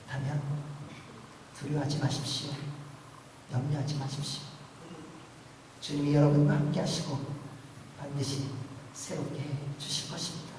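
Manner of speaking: quiet, tender comforting; calm the fears gently; soft reassurance. Acted. Pastor comforting the anxious congregation, softly.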